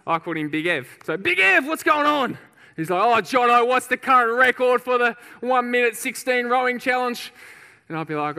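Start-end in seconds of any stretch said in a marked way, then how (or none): 1.2-2.4 high, loud hail to a mate; hearty called greeting. Retold or imitated. Officer greets big mate at the fire station, hearty loud call. 3.0-7.3 loud, raised voice of a big bloke; booming eager question. Retold or imitated. Big firefighter asks mate for the rowing record, loud and eager.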